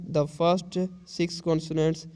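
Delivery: very slow, word by word; slow dictation read. Shown as a demonstration. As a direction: read it out slowly for the class. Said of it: "teacher reads text slowly".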